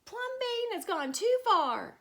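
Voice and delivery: high, loud, outraged shout; neighbours shouting. A narrator's reading. The neighbours shout at Plumbean over his house. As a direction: yell it at him in outrage. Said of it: "the people shouted".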